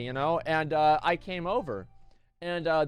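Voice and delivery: ordinary talk, nothing marked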